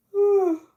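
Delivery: loud yawn falling away; big yawn. Real, not acted. Streamer apologising to chat for nonstop yawns, loud yawn. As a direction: yawn long and loud, then let it drop. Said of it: "because I keep yawning".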